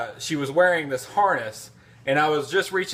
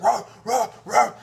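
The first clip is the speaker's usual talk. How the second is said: high yappy barks, higher pitch; dog bark imitation; 8 semitones higher, 3 dB louder than usual. Man imitating his bulldog barking while dragged home. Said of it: "she's like".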